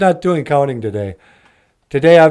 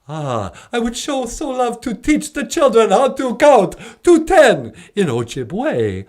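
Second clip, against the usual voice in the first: eager, high vampire voice ending in cackles; Count Von Count; 10 semitones higher, slower than usual. Acted. Count offering to teach children counting, eager vampire voice. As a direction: gush in a swooping puppet-vampire accent.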